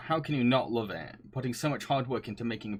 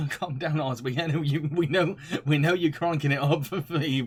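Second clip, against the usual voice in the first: laughing tease; 4 semitones higher, 5 dB louder than usual. Real, not acted. Teasing chat for cranking it up.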